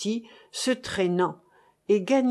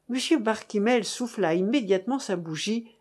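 ordinary delivery, nothing marked

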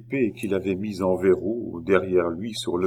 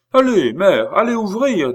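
high, loud, exasperated order; furious; 11 semitones higher, 7 dB louder than usual. A narrator's reading. Oliva sends her mother to the door, furious. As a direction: order her off in a raised, angry voice. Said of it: "toute furieuse".

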